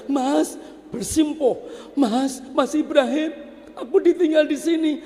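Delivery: high, begging voice, speeding up at the end; a kneeling wife begging in a high voice; pleading wife. Acted. Kneeling wife begs husband not to leave, high plea.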